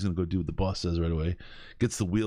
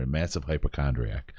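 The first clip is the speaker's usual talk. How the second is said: usual speaking voice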